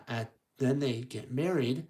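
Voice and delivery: usual speaking voice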